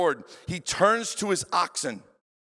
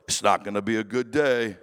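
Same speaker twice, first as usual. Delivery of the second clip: much lower, deadpan animal voice; a glum ox resigned to its fate; 9 semitones lower than usual. Retold or imitated. The surviving ox looking at its partner, low and deadpan. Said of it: "The other ox looks over and goes".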